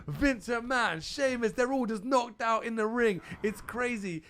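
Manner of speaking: ordinary talk, nothing marked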